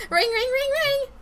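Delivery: very high, sing-out pretend ringtone; fake phone ringing. Coworker faking a phone call to escape, high mock ringing. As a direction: imitate a phone in a high, silly voice. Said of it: "you're just saying ring".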